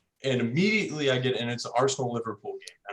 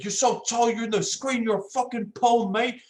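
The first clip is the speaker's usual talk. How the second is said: much higher, hammed-up taunting Londoner; mock aggressive local; 10 semitones higher than usual. Pub local taunting the tall kid, high mock jeer. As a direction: taunt like a drunk pub bully. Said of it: "He's like".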